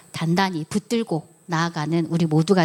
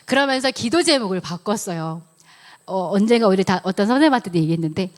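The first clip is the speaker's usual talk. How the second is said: ordinary talk, nothing marked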